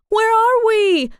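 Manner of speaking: very high, loud, drawn-out shout; shouted high and long. A narrator's reading. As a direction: yell it into the dark. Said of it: "exclaimed Jade".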